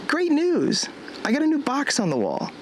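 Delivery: overly bright sarcastic announcement; sarcastic cheer. Real, not acted. Sarcastic cheer after setback.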